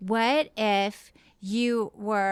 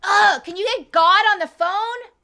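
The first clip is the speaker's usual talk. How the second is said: impatient boss calling out; bossy Jesus; 9 semitones higher, 3 dB louder than usual. Acted. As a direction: demand it like a busy executive. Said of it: "do you wanna play Jesus".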